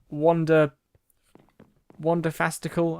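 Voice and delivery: usual speaking voice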